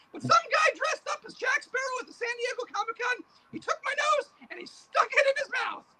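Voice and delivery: a high cackling boogeyman; boogeyman puppet voice. Acted. Puppet boogeyman greets the interviewer in a high creepy voice. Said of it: "Hey, boogeyman, come over here".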